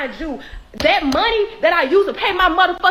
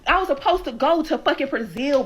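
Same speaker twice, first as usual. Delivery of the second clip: ordinary talk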